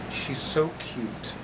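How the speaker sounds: normal talking voice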